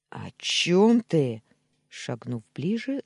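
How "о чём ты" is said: loud, slow, threatening question; angry growl. A narrator's reading. Nephew steps closer to uncle, growling. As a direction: demand it with slow menace. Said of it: "зарычал я".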